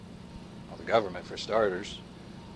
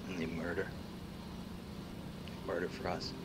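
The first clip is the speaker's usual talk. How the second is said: lower, much softer, grave; grim hush; 9 semitones lower, 16 dB quieter than usual. Acted. Revealing machines kill for us, in a grim hush. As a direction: drop the voice on murder.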